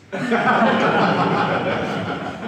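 big breathy burst of laughter; laughing at his own joke. Real, not acted. Lecturer laughs at his own Poisson notation joke, breathy laugh.

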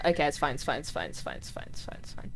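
fading from plain talk to a whisper. Real, not acted. Nervous streamer soothing herself, fading to a whisper. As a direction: talk yourself calm until it's barely breathed. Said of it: "self-soothing mantra fading to whisper".

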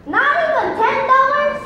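high-pitched, indignant question; incredulous protest. Acted. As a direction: push back in disbelief at being refused. Son protests the refusal.

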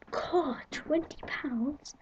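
slow whispered disbelief at the price; nan's whispered gasp at the cost; whispered shock. Retold or imitated. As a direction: hush it out, stunned and slow.

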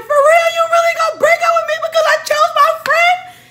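high, loud outraged yelling; shouting at boyfriend who dumped her. Retold or imitated. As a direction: blow up at him in disbelief. Retold heated argument.

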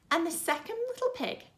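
plain reading voice

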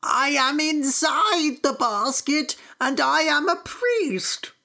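high, slow storybook pleading; panicked priest. A narrator's reading. Priest about to be thrown in the river, pleading high. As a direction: wail it out from inside the basket.

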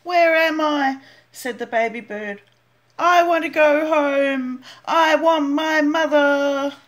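high, loud, slow and plaintive; pleading. Acted. Lost baby bird begging for his mother, slow and plaintive. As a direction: beg to go home.

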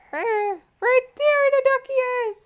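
put-on high, slow singsong to a toddler; high baby-talk voice.

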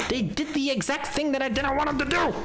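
high, whiny outrage; high exasperated gamer whine. Real, not acted. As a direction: complain as if betrayed. Rant about unwanted collectibles.

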